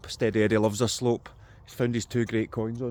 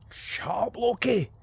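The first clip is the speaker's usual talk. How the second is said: calling out to dog; 10 semitones higher, slower than usual. Real, not acted. Shouting at the dog.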